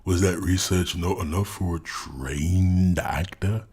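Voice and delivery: ordinary talk, nothing marked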